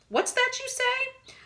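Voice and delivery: put-on high, startled old voice; distracted old man. A narrator's reading. Distracted Mister Willow asking Homer, high and startled. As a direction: snap out of a daydream. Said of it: "momentarily distracted".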